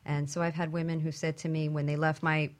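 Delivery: ordinary talk, nothing marked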